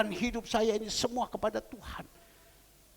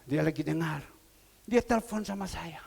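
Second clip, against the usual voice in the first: ordinary delivery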